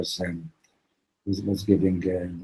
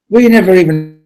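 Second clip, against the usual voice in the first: much higher and louder, pleading; desperate confession; 10 semitones higher, 12 dB louder than usual. Retold or imitated. Preacher confessing to the axe man, high frantic pleading. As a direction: plead your sins in a panic.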